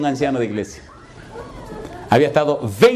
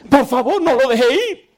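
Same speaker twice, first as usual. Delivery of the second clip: high-pitched desperate plea; 9 semitones higher, 3 dB louder than usual. Retold or imitated. Churchgoer pushing the pastor outside, pleading desperately. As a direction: beg urgently, voice pitched way up. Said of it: "como desesperado".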